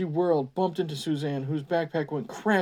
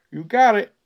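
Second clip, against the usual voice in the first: much louder, called across; calling out; 6 semitones higher, 11 dB louder than usual. Acted. Kyle calling back to Boone, much louder. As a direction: shout it back from outside. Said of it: "Kyle called".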